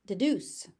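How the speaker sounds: normal talking voice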